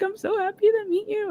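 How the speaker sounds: high-pitched happy squeal; gushing excitement. Retold or imitated. Star hugging the fan a second time, gushing. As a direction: imitate her gushing, way up high.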